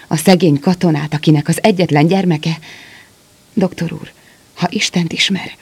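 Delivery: pleading in breathless bursts; gasping out his plea, out of breath from running; halting, breathless. A narrator's reading. Soldier begs the doctor for his daughter, halting and breathless. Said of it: "Akadozón beszélt".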